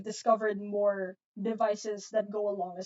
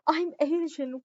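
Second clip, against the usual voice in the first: higher and slower, through a laugh; 5 semitones higher than usual. Real, not acted. Tutor laughing as she repeats her answer to chat, amused.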